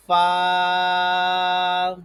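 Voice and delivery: فا from the lips, vowel held long and loud; lip-made fa. Shown as a demonstration. Teacher shows a lip letter, long loud فا. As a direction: sustain فا evenly on one note. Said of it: "جو ہونٹوں سے ادا ہوتا ہے".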